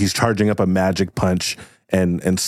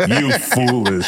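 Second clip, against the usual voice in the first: high villain snarl; 12 semitones higher, slower than usual. Acted. Villain Cristobal scolding.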